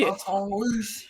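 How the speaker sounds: "Baton Rouge" stretched slow in a put-on Southern drawl. Teasing the coach's new accent on Baton Rouge. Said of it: "Can he say Baton Rouge?".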